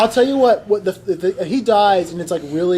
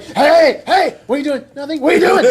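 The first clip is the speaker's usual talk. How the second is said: high, angry cartoon outburst; angry character; 6 semitones higher than usual. Villain catching someone with the dragon, mock-angry high voice. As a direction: play angry, voice pitched up. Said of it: "he's really angry".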